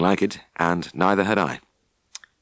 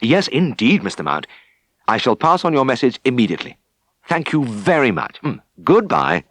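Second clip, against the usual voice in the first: eager phone voice; 5 semitones higher, 4 dB louder than usual. Acted. Tristan eager on phone with farmer.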